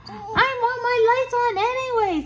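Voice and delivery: very high little ghost voice; squeaky-high, insistent little ghost. A narrator's reading. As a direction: protest like a small ghost, way up high. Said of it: "said the little ghost".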